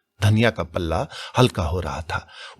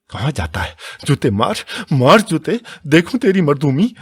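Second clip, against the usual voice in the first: furious taunt; 7 semitones higher, 5 dB louder than usual. A narrator's reading. Dhaniya taunts Hira after pushing him.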